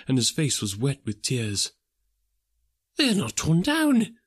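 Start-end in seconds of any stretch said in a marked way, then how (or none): 3.0-4.2 higher, delighted exclaiming; excited cry. Acted. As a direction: cry out in glad relief. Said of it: "cried Scrooge".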